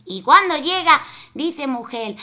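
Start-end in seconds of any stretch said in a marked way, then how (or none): none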